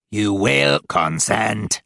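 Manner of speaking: slow, buzzing demon command. A narrator's reading. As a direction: drone it out very slowly. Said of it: "The buzzing voice".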